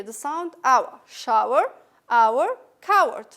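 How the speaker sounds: hour and coward said loudly with a drawn-out ending; loud modelled pronunciation. Shown as a demonstration. Teacher demonstrating the hour sound to students. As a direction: hit each word hard for the class. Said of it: "So what do you hear?".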